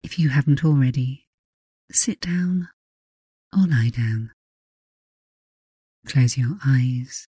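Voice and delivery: calm guided hypnosis, lingering pauses; hypnotic voice. Acted. Leads a hypnosis session.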